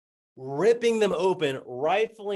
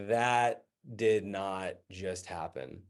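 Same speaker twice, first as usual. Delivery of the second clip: slow, low, softened deadpan; low stunned understatement; stunned disbelief; 8 semitones lower, 5 dB quieter, much slower than usual. Acted. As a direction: say it very slowly, numb with disbelief. Stunned, holding the soaking folder.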